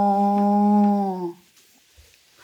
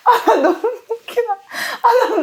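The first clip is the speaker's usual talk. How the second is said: laughing; 12 semitones higher, slower than usual. Real, not acted. Laughing at his outburst.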